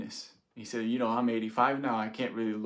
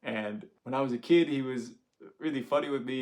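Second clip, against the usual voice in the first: normal talking voice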